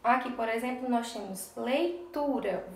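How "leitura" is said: leitura slowly, with TU as the strong syllable; stress on TU. Shown as a demonstration. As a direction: stretch leitura and lean on TU. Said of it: "a sílaba tu é a mais forte". (leitura devagar, com TU mais forte)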